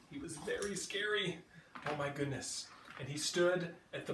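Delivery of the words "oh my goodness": plain reading voice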